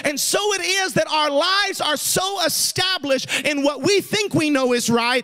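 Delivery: rousing preacher's cadence; preaching. Real, not acted. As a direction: preach it with conviction, drawing out the key phrases. Preaches a point at full force.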